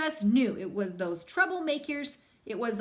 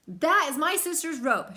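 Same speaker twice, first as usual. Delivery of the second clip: shouted; 4 semitones higher, 5 dB louder than usual. Acted. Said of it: "shouted Brother".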